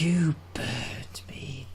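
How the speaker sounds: breathy whispered Welsh Bond; sensual whisper. Retold or imitated. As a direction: whisper it seductively, slowly. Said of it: "gets more sensual every time".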